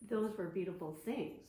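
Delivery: plain reading voice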